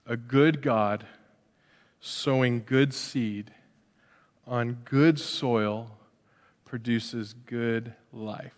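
slow, soft, weighted words; slow solemn emphasis. Real, not acted. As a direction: land each phrase deliberately. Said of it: "closing line slowed for weight".

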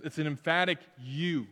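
ordinary talk, nothing marked

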